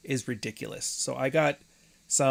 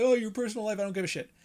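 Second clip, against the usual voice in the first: dismissive viewer; 9 semitones higher, faster than usual. Retold or imitated. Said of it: "I know, it's like".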